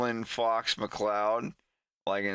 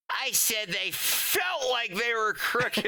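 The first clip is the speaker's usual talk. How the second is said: much higher, prim correction; pedantic kid; 9 semitones higher than usual. Retold or imitated. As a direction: correct him with mock precision. Fox clarifies, smug.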